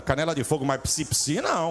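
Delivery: ordinary talk, nothing marked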